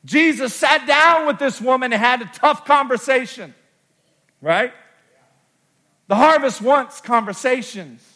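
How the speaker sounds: preacher at full force; preaching climax. Acted. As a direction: hammer the point home. Preacher drives home point.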